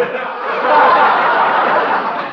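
loud breathy burst of laughter; surprised laugh. Acted. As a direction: burst out laughing at mom's joke. Harold laughs at mother.

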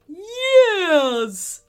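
high, loud laughing yes; a toy bear giggling yes; laughing teddy bear. A narrator's reading. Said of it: "laughed the teddy bear".

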